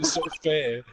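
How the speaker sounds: lightly put-on Jamaican accent whine; terrible Jamaican accent. Gamer joking with friends, mock Jamaican accent. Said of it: "terrible Jamaican accent".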